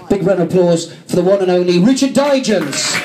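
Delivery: rising stage announcer build to the name; announcer introduction. Real, not acted. Host calling up a guest for applause, announcer-style. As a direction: bring on the act, lifting pitch on the name.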